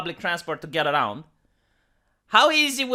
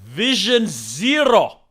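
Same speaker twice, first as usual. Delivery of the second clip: Zero drawn out slow and louder, like a grand title; mock grand slogan; 2 semitones higher, 7 dB louder, much slower than usual. Shown as a demonstration. Mocking the transport minister's program name, slow grand announcement. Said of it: "That's what he calls it".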